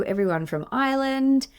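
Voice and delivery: ordinary talk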